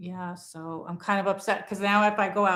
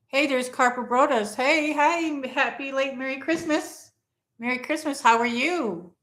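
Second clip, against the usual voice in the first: high, loud, happy hello to a viewer; light up greeting a chat friend; bright excited greeting; 6 semitones higher, 5 dB louder than usual. Real, not acted.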